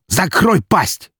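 slow, high, angry shout; enraged, drawn-out interrupting order; cutting her off. A narrator's reading. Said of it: "перебил он её".

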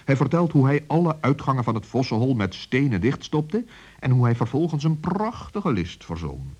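ordinary delivery

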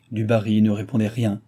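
ordinary delivery

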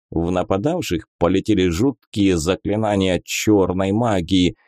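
ordinary delivery

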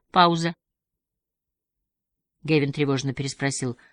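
ordinary delivery, nothing marked

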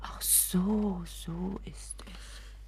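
slow, hushed realization; stunned whisper. Acted. Mother realizes the danger, slow stunned whisper. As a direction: let it sink in, whispering.

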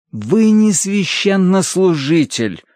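high, slow indignation; indignant clergyman. A narrator's reading. Pale clergyman puts a workman down, indignant. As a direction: retort slowly in a high voice. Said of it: "ещё больше выкатил глаза священник".

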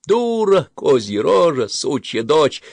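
put-on high, loud childish jeering; mocking playground shouts; name-calling taunts. A narrator's reading. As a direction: hurl insults at a neighbour kid. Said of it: "просто обзывали".

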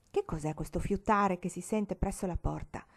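ordinary delivery

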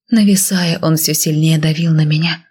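plain reading voice

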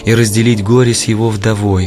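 ordinary talk

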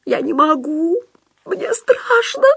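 high, crying plea; tearful fear. A narrator's reading. Худяков wipes tears and begs off, high tearful whine. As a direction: whimper it high, dragging the last words. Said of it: "Худяков растирал по лицу слёзы".

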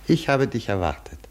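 ordinary talk, nothing marked

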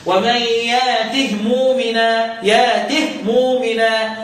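ترتيل بطيء بهاء ساكنة في "يأته"; إبدال الهمزة مدًا في "يأته" مع تسكين الهاء; قراءة السوسي بالإسكان. Shown as a demonstration. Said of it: "هو الوحيد اللي هيسكن الهاء". (رتّل على مهل وسكّن هاء "يأته")

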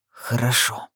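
grudging, low concession; understated reluctant agreement; forced out. A narrator's reading. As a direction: say it reluctantly, as if it costs you. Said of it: "словно через силу произнёс он".

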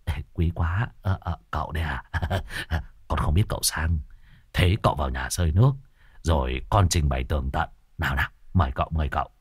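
low, soft ingratiating voice; fawning youth. Acted. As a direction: suck up to the guest, softly. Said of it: "gãi gãi cái đầu chọc".

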